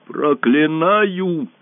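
high, long-drawn curse; loud curse. A narrator's reading. Dying empress cursing those who shot her, loudly. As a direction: draw the word out high, proclaiming it. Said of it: "произнесла громко императрица".

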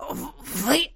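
soft, choked stammer; strangled hiss. A narrator's reading. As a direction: splutter as if choking. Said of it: "Капитан сдавленно зашипел". (сдавленно, заикаясь)

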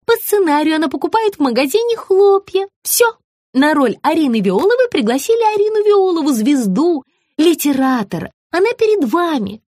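high-pitched eager pitch; high sugary woman. A narrator's reading. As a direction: sell it eagerly. Margarita's voice.

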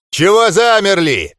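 loud, high furious snarl. A narrator's reading. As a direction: roar it at frozen troops. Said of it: "разъярённо прорычал Ндорк".

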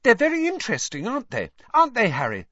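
quick, high and eager; said quickly. A narrator's reading. Hermione quickly covering for Hagrid, fast and high. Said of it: "said quickly".

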